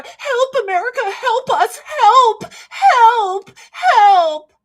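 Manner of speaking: high, loud pleading, close to crying; hammed-up panicked plea for help; mock cry for help. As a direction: beg like a helpless country calling for rescue.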